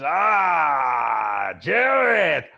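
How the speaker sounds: big booming shouted troll welcome, pitched high; loud troll greeting. Acted. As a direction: bellow the greeting like an overjoyed troll. Troll NPC greets Jareth.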